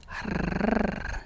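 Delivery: long purr-like tongue trill; rolled R trill. Shown as a demonstration. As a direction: roll a sustained R like ASMR. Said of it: "There's some A S M R".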